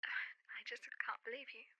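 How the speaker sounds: sigh, then breathe it out, slow and broken; barely voiced, too crushed to speak up; hurt whisper. To Vinyl, who skipped her concert, hurt whisper.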